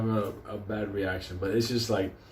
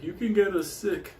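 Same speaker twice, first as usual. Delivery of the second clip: higher mock-whiny teller; mocking bank teller; 8 semitones higher than usual. Imitating the teller behind the glass, higher and whiny. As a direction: whine it up high, mocking her. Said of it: "she's like".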